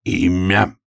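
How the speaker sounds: stern official demand. A narrator's reading. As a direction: ask curtly like an official. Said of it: "строго спросил".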